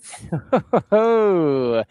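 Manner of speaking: loud high laughing "hoo"; delighted hooting laugh. Real, not acted. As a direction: laugh into a long falling whoop. Reacts to the goal replay.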